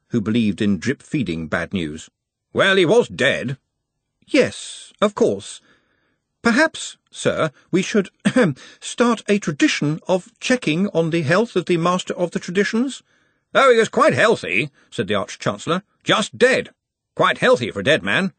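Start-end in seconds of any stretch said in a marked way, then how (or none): 2.5-13.0 put-on higher, hesitant young wizard; a nervous young wizard delivering bad news; Ponder voice. A narrator's reading. Ponder voiced in a novel reading. 13.5-18.3 put-on blustering old Arch-Chancellor; a bluff, confident old wizard; Ridcully voice. A narrator's reading. Ridcully voiced in a novel reading.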